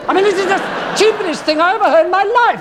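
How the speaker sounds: losing patience with a silly guest; exasperated. Acted. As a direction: rattle it off in disbelief. Interviewer exasperated by guest's noises.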